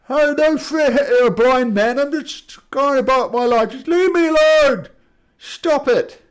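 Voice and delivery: high, loud wailing protest; hammed-up indignant monster shouting at bullies; aggrieved giant. Blind giant protesting to his attackers, loud and aggrieved.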